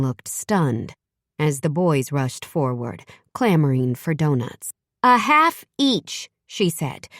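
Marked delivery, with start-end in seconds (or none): 5.0-6.3 firm mom ruling. A narrator's reading. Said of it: "her pronouncement met with big-time moaning and groaning".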